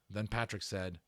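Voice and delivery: usual speaking voice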